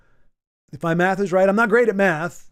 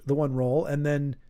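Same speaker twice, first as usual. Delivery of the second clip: ordinary talk, nothing marked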